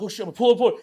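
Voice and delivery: high, urgent blurt; retold panic. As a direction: replay a sudden panic in the car. Panicked reaction in retold car ride.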